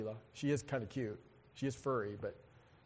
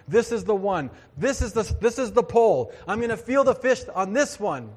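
louder, higher, building hope; eager anticipation; 5 semitones higher, 6 dB louder than usual. Acted. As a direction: urge it on like an angler on the winning cast. Voicing a hopeful angler casting.

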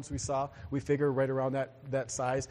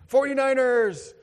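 high, loud, drawn-out team cheer; mock fan cheer; 9 semitones higher, 7 dB louder than usual. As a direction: holler it like a football fan. Mimicking cheering football fans.

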